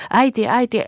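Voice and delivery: high, loud, panicky cry; frightened calling. Acted. Children calling in panic after their mother. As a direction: call after her in alarm. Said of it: "hätäillen huusivat".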